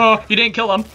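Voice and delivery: high, loud alarmed cry; excited. Real, not acted. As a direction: yelp it in surprise. Excited reaction to enemy.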